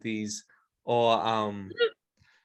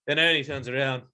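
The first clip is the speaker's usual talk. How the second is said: usual speaking voice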